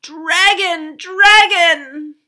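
long, high, loud holler; alarm shout. A narrator's reading. Arabella raising the alarm from the hill, shouting. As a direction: holler it out from the hilltop. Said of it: "there came another shout from the hill".

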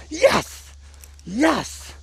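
high-pitched triumphant yes; jubilant. Real, not acted. Vlogger celebrating being proven right, jubilant. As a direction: celebrate with a high, exultant cry.

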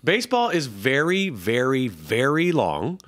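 very, very, very drawn out slowly; stretched very. Acted. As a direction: lean on each very, slowly. Mocking baseball's length.

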